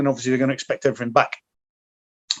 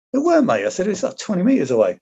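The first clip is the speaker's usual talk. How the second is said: mock dismissive retort; 8 semitones higher than usual. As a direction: brush him off in a lightly mocking higher voice. Speaker retorts in the argument.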